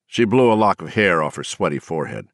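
ordinary delivery, nothing marked

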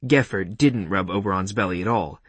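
plain reading voice

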